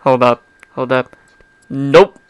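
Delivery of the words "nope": firm. Acted. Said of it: "I stated firmly".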